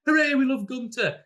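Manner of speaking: hammed-up hooray from delighted players; mock cheer. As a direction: squeal it like a fan club. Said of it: "It was like".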